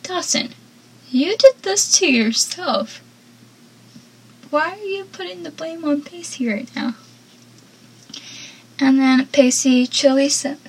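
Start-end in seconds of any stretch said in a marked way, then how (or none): 0.0-7.0 exasperated. Retold or imitated. Fan scolding Dawson on screen, exasperated and hushed. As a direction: scold him like a frustrated fan. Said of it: "made me so mad because I'm like".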